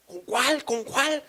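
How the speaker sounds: high, loud feigned ignorance; flustered denial. Acted. Caught husband to his wife, flustered denial.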